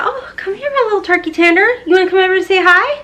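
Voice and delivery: high sing-song voice calling her cat over. Real, not acted. Cooing baby talk to her cat.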